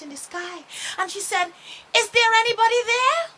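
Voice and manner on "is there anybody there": loud, high, quick call. Acted. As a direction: shout across the sky to find them. Starchild calls out into sky.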